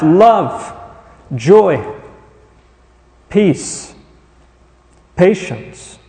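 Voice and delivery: one word at a time, long pauses between; deliberately slow reading. Real, not acted. Preacher slows the list so the congregation listens, deliberate and spaced out. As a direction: read the list very slowly, letting each word land. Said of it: "You may think I'm reading this too slow".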